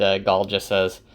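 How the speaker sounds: normal talking voice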